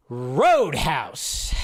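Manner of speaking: ordinary talk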